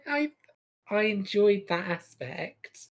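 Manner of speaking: usual speaking voice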